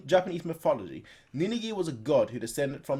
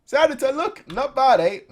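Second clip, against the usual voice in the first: high, louder show-off; boastful character voice; 11 semitones higher, 9 dB louder than usual. Acted. Character showing off his riding to the senator, high and boastful. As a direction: crow about the trick.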